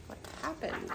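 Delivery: ordinary delivery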